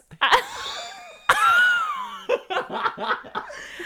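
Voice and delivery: high shrieking laughter. Real, not acted. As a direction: crack up in shrill giggles. Laughing at the mix-up.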